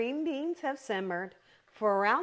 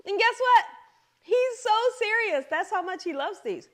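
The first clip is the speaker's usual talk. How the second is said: higher and much louder, bursting with delight; excited; 10 semitones higher, 6 dB louder than usual. Real, not acted. Telling viewers how much her husband loves these, excited. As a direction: shout it out like happy news. Bragging about husband's love of dish.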